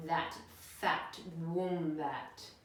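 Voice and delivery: very slow, sinister reveal; sly dingo. Acted. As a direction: savour each word slyly.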